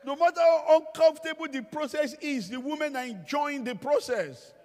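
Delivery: high, loud preaching cry; emphatic shout. Real, not acted. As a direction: drive the point home at full voice. Emphatic point shouted to audience.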